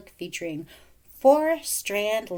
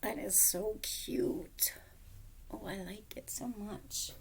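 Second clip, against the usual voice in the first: whisper; 10 dB quieter, slower than usual. Real, not acted. Whispered delight.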